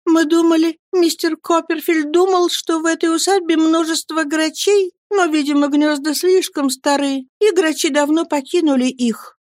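put-on a shy young widow answering; timid young mother. Mother voiced in story. (высокий робкий голос матушки)

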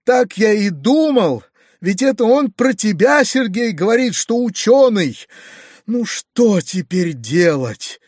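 throwing up his hands in alarm; dismayed exclaim. A narrator's reading. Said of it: "вскричал дядя, всплеснув руками". (взволнованно, громче и выше)

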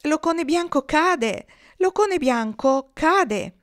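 high, loud and slow; shouting alarm. A narrator's reading. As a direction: cry out in alarm. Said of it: "gridarono le oche di coda".

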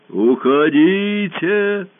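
very slow, loud booming command; muffled monster voice. A narrator's reading. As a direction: boom it out over the forest. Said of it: "пронёсся над лесом глухой голос".

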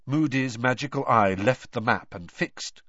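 plain reading voice

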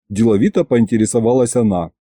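ordinary delivery, nothing marked

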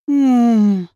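helpless drawn-out moan; weak groan. A narrator's reading. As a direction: groan in weary defeat. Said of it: "бессильно простонал Вааш".

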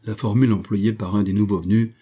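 plain reading voice

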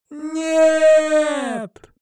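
put-on very high, drawn-out girlish shout; exclaimed cry. A narrator's reading. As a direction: shout it long and high in horror. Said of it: "воскликнули девчонки в унисон". (отчаянный крик высоким голосом)

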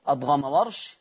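usual speaking voice

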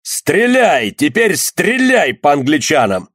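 high, slow, drawn-out yell. Acted. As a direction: howl the order to fire. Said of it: "завопил Кернок".

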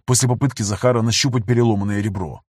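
ordinary delivery

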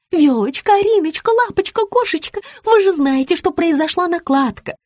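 high-pitched wheedling whine; whining. A narrator's reading. Rita excuses the mix-up to her client, whining. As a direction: plead sweetly through a string of pet names. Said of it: "заныла Рита".

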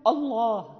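"الله" with a heavy, dark opening hamza; thickened hamza. Shown as a demonstration. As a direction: wrongly thicken the first "a" of "الله". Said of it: "لا،".

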